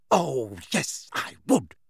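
grimly emphatic confirmation; understated grim resolve. A narrator's reading. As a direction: make the threat stick. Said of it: "said Hermione grimly".

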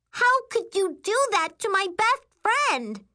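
very high, shrieked reproach; explosive outrage. A narrator's reading. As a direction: explode at your sister. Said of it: "then she explodes again".

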